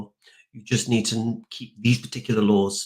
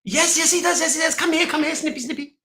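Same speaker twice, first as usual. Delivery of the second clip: very high, squeaky, hammed-up menace, slowing down at the end. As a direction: squeal it out like a comic villain.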